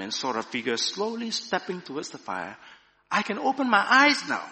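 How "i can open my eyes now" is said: high, loud proclamation; joyful proclamation. A narrator's reading. Woman by the fire as her eyes adjust, loud proclamation. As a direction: proclaim it, voice lifted and strong. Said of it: "she proclaimed".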